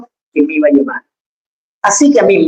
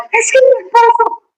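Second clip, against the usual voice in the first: high, whimpering excuse; whiny complainer. Retold or imitated. Mocked complainer answers the preacher.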